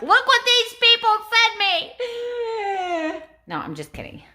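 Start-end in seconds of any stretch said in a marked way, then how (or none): 0.0-1.9 high, loud teasing squeal; mock bragging wife. Wife pretending to taunt husband over flowers, squealing. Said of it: "I'm gonna be like". 2.0-3.2 high swooning sigh; dreamy mock sigh. Wife teasing husband, swooning sigh. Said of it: "I'm gonna be like".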